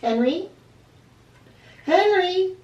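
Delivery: high, loud worried call. Acted. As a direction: call out into the dark. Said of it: "Angelina called".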